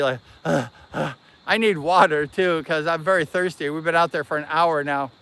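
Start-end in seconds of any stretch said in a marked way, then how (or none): 0.4-1.1 imitated gasps for air; two short, low panting breaths; heavy breathing. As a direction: act out being out of breath. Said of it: "I'm, like, breathing very heavy".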